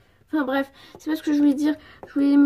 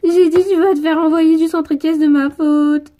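loud, insistent repeat; forceful, fed-up yell; exasperated shout; 3 semitones higher, 9 dB louder than usual. Acted. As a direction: shout it at someone who didn't understand. Repeats herself loudly after being misunderstood.

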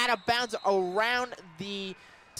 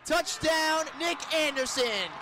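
higher and fast, shouting the score; excited touchdown call; 6 semitones higher than usual. Real, not acted. Play-by-play man shouting a touchdown to radio listeners, excited shout. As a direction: yell it as he crosses the line.

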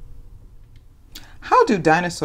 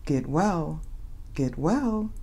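soft bedtime read; 3 semitones lower, 11 dB quieter than usual. A narrator's reading. Bedtime ending of the story.

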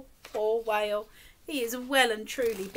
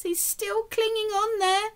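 teary, higher storyteller worry; mock fearful; 8 semitones higher than usual. Reader voicing the snail's fear to children, light teary worry. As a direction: let a little pretend cry into it. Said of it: "very frightened".

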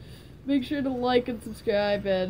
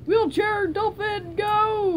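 high, slow battle cry; rally the wheelchair charge; mock battle cry; 10 semitones higher, slower than usual.

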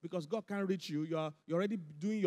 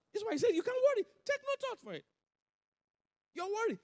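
pitched way up, urgent and insistent; high emphatic preaching; 13 semitones higher than usual. Acted. Preacher voicing Jesus to worried listeners, high and insistent.

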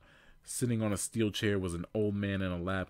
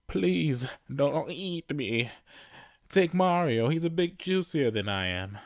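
higher, pleading cartoon plumber; scared begging; 7 semitones higher, 5 dB louder than usual. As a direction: beg in a panic to be spared.

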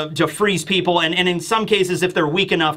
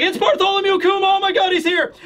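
much higher, astonished voice; shocked bystander seeing a legend; amazed gasp; 13 semitones higher than usual. Acted. Onlookers spot Kuma arriving, much higher astonished exclaim.

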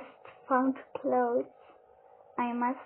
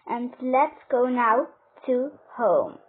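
ordinary delivery

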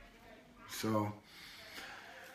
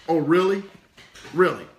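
lightly voiced skeptical monkey puppet; puppet Pepper; 3 dB louder than usual. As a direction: challenge it as the puppet's comeback. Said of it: "and Pepper's like".

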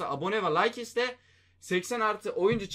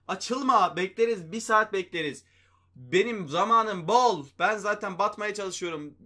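high, loud mock patience, drawn out; sarcastic, exasperated waiting; sarcastic impatience; 4 semitones higher, 5 dB louder, slower than usual. Real, not acted. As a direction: needle the pack sarcastically with a raised voice. Sarcastic about slow pack.

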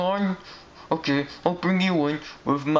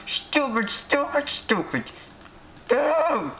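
mock angry kid; 8 semitones higher than usual. Kid insulting and chasing out.

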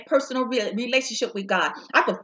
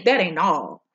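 the Lord's voice, lower and set apart; 5 semitones lower, slower than usual. Acted. Preacher voicing the Lord's word to listeners, lower. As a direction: speak it as the Lord's answer. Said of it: "I hear the Lord say".

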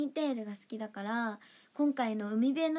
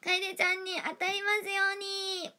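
mock prayer; 9 semitones higher than usual. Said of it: "当選祈願するね".

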